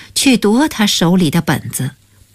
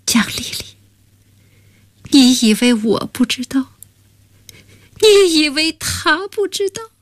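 tender, tearful reply; 8 semitones higher than usual. Acted. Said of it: "忍不住抱住她说".

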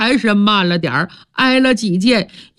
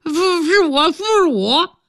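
a hint of a hurt, strained voice, higher; lightly voiced wounded man; 8 semitones higher than usual. A narrator's reading. Wounded Liuzhi weakly confirms who he is, strained. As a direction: answer through the pain.

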